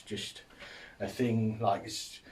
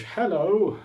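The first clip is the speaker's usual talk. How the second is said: bright high hello; 9 semitones higher, 5 dB louder than usual. Retold or imitated. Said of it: "But when someone goes".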